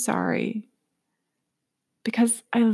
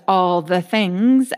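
ordinary delivery

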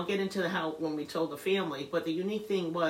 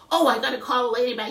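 flustered; 7 semitones higher, 9 dB louder, faster than usual. Retold or imitated. Retold flustered realization to husband.

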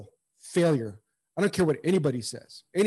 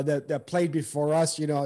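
usual speaking voice

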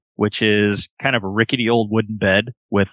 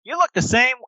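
high, sweet toy-animal voice; childlike plush friend; 5 semitones higher, faster than usual. Acted. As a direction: speak like an eager stuffed elephant bear. GM voices Billy Bucks.

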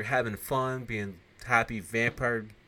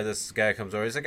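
ordinary talk, nothing marked

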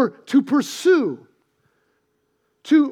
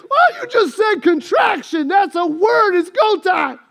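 frantic husband; 4 semitones higher, 4 dB louder than usual. Retold or imitated.

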